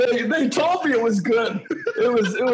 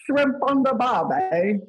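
bad Australian accent; 4 semitones higher than usual. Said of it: "are we bad".

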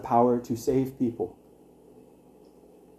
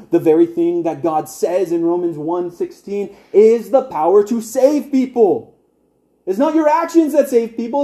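high, loud, fervent preaching; preaching at full force; 8 semitones higher, 4 dB louder than usual. Acted. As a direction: preach it out to the room with rising force. Preaching climax.

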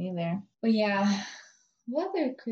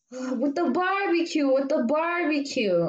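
slow, higher, dragged-out customer voice; spaced-out customer; 4 semitones higher, slower than usual. Retold or imitated. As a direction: imitate someone groping for the word. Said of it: "I don't know what she was off".

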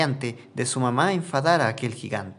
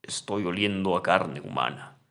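terrible giant voice; 6 semitones lower than usual. Acted. Giant sniffing the air for Juan, low menacing voice. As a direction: growl it out like an unseen giant. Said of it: "habló una voz terrible".

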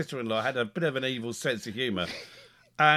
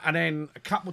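usual speaking voice

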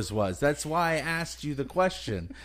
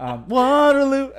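laughing silly guess; 10 semitones higher, 8 dB louder, slower than usual. Real, not acted.

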